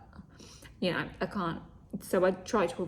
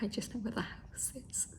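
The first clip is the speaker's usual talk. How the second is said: breathy whispered aside; a whispered I have no clue; whispered exasperation; 3 semitones higher, 9 dB quieter than usual. Retold or imitated. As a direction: whisper it like a confession you're fed up. Continuing the aside.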